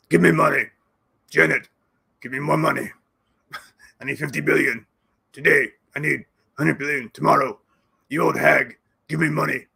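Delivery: loud put-on voice demanding cash; mock Zelensky begging. Mock Zelensky demanding money from Yellen, put-on voice. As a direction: whine and bark for money like a greedy caricature. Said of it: "as he would say".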